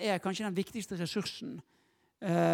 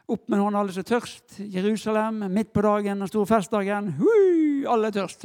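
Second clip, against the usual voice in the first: calling to crowd; 8 dB louder than usual. Acted. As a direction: hail a big festival crowd, mock-rousing. Imagines Jesus hailing the festival crowd.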